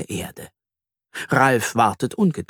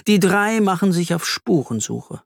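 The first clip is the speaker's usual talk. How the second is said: plain reading voice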